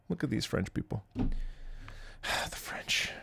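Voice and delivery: unvoiced sighing whisper; muttered aside. Real, not acted. Streamer grumbling about French viewers, whispered aside. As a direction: whisper it under your breath.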